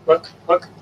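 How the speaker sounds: ordinary talk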